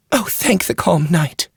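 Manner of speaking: a worried court lady relieved to see him; anxious noble lady. A narrator's reading. Wheeler voiced in the story.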